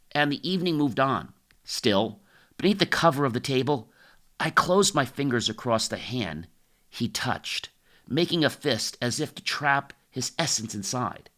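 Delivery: plain reading voice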